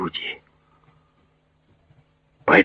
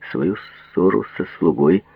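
ordinary delivery

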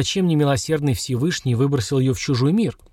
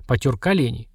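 usual speaking voice

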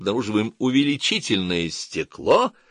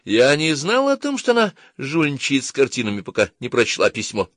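higher, thin-voiced interjection; thin high girlish butting-in; thin little voice; 7 semitones higher than usual. A narrator's reading. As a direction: pipe up in a small, high voice. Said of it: "тоненьким голоском вмешалась она".

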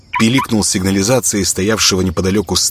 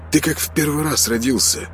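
higher-pitched, mildly incredulous; surprised; 8 semitones higher, 4 dB quieter than usual. A narrator's reading. Konstantin to his naive friend, surprised. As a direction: marvel softly at his naivety. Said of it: "удивлённо сказал Константин".

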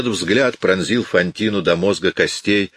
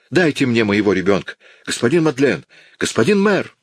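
much higher, desperate rising pleas; frantic pleading; 5 semitones higher than usual. A narrator's reading. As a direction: beg everyone for her child in panic. Fantine panics about her child.

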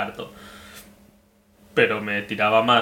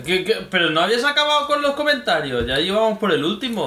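surprised; 9 semitones higher than usual. Real, not acted. Amazed the comments aren't over.

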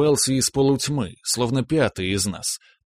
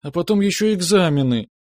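whiny little sister; 8 semitones higher, 4 dB louder than usual. A narrator's reading. Nastya continues complaining.

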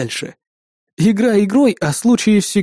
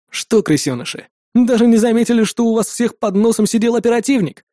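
gloating taunt, rising higher; 6 semitones higher than usual. A narrator's reading. Operative mocking the captured terrorists, gloating. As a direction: taunt them with malicious glee. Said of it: "злорадно усмехнулся я".

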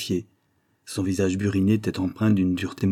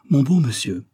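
higher, louder, mildly impatient protest; impatient exclamation; 5 semitones higher, 5 dB louder than usual. Acted. Mr Cunningham objecting to Holmes's house search, impatiently. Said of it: "s'écria non sans impatience".

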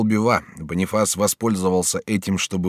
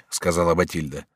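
usual speaking voice

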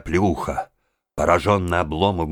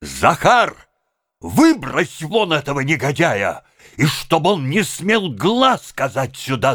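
loud, higher commanding shout; shouting an order; 9 semitones higher, 4 dB louder than usual. A narrator's reading. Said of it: "закричал Обломов".

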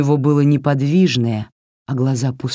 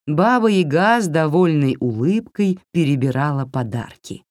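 plain reading voice

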